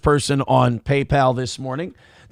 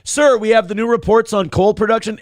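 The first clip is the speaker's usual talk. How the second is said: aide's report; 6 semitones higher, 3 dB louder than usual. Pretend. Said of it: "Excuse me".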